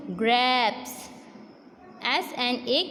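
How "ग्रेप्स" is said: ग्रैब्स as "grapes", the A held extra long; ग्रैब्स with a lengthened "ay"; long vowel. Shown as a demonstration. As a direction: draw out the vowel in ग्रैब्स slowly. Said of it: "थोड़ा ए का sound लंबा होता है".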